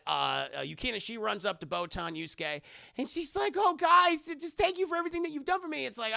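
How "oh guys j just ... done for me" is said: put-on high, gushing girl's voice; high-pitched acted thanks; imitated gratitude. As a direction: gush thanks in a raised imitation voice. Voicing grateful girl.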